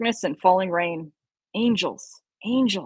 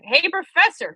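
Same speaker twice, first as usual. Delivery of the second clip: raised call across the cave; 8 semitones higher, 3 dB louder than usual. Acted. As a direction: call out to the professor. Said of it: "he called".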